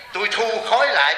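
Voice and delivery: ordinary delivery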